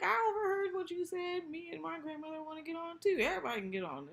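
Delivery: high, loud mock voice; hammed-up entitled rich kid; high mock Gallant. Retelling Gallant answering Brock.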